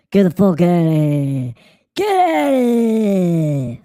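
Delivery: loud, drawn-out yell; mock shout. Streamer yelling a spammer out of the chat. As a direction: bellow them out of the chat, slowly.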